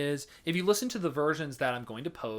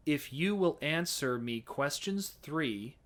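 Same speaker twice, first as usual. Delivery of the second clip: "If you will answer me questions three" in plain American with full hard R's; straight American; slower than usual. Shown as a demonstration. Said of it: "here's how that would sound in straight up American".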